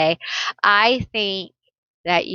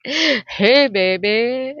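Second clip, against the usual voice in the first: flirty come-on; 3 semitones higher, much slower than usual. Pretend. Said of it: "this one's like".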